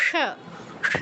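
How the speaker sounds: "স" as sh with the tongue folded back; retroflex sha. Shown as a demonstration. Teacher demonstrating folded-tongue pronunciation, "স" as retroflex sha. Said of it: "জিহ্বাটা fold করে মূর্ধন্য স্বর".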